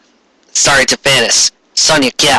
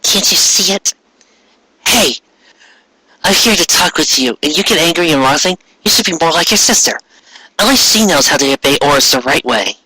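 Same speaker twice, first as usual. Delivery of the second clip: lightly voiced girl, higher and scolding; 4 semitones higher than usual. Friend scolding Ami for not obeying, lightly higher voice. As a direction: nag her like a bossy friend.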